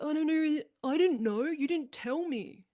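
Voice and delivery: mock petulant husband; petulant child attitude. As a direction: sulk like a defensive teenager. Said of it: "adolescent kind of petulant child attitude".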